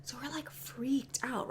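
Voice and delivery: slow hushed whisper; whispered fright. Real, not acted. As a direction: breathe it out scared and slow. Whispered spooky recap.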